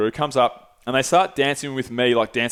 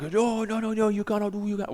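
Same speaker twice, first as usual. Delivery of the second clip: higher, mock Portuguese accent, protesting; a hammed-up Brazilian bouncer objecting in a Portuguese accent; 7 semitones higher, 6 dB quieter than usual. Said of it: "yelling at his dude".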